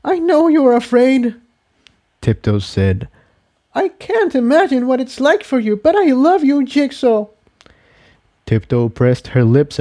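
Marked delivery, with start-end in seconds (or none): 0.0-7.3 lightly voiced mare reassuring him; voiced mare, tender. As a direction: Tiptoe comforting frightened Jigsaw. Character speaks tenderly.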